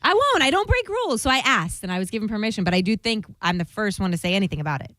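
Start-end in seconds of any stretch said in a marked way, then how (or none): 0.0-1.2 mock innocent. Claims to never break rules.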